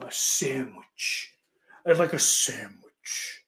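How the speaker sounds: slow, careful "sandwich" with every sound in place; prim proper sandwich. Shown as a demonstration. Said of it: "it sounds dumb".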